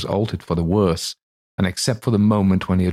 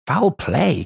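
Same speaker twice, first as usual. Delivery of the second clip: startled cry; 9 semitones higher than usual. Acted. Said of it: "cried the lawyer".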